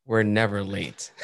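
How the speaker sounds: slow, understated punchline; deadpan mock German. TikToker playing a punctual German, slow deadpan. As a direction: imitate a German stating it slowly and matter-of-factly. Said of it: "This is how Germans act".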